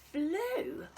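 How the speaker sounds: lively storybook read-aloud with a hushed edge; reading to children. A narrator's reading. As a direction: read it like a bedtime picture book, playful and rhythmic. Reads picture book to children.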